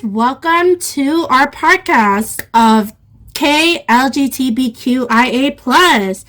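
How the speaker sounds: ordinary talk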